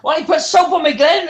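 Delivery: high-pitched mock request; acted high wheedle; pestering plea. Acting out pestering Glenn.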